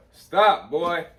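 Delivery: scolding shout. Real, not acted. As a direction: call off the dog sharply. Scolding the dog.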